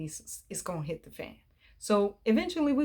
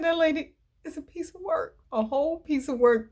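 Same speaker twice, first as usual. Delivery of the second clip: amazed; 8 semitones higher than usual. Real, not acted. Amazed reaction to the mother.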